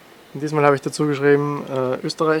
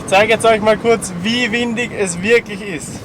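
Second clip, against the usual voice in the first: raised voice; 8 semitones higher, 3 dB louder than usual. Real, not acted. Speaking into strong wind.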